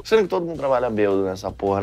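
ordinary delivery

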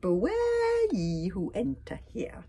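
slow, high wavering eerie call; ominous warning. A narrator's reading. Pirate's carved warning over the hut door, ominous. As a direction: stretch the first word like a ghost.

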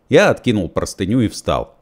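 plain reading voice

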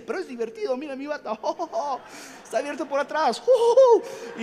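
put-on high, giggling kid's glee; excited child. Boy showing his gown to his parents, giggling and squealing. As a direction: squeal with delight over the gown.

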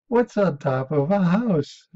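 slow, high, loud question; lightly acted owner coaxing the dog; dog owner quizzing. Dog owner quizzing his talking dog.

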